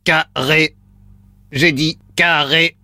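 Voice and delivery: deep slow robot voice; computer processing. Pretend. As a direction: drone it like a machine. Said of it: "Voix de robot enclenchée". (voix de robot lente et grave)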